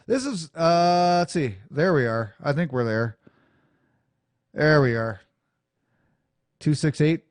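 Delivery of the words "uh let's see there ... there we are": ordinary talk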